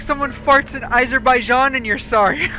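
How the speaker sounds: mock exasperated rant. Friend teasing a chronic apologiser, loud mock rant. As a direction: complain loudly as the fed-up friend.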